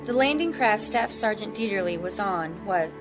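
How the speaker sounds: ordinary talk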